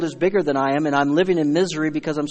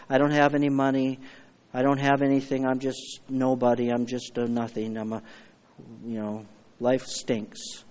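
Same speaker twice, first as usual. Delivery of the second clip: mock despair; 4 semitones lower, 7 dB quieter than usual. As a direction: sink lower with each line. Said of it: "looking down here".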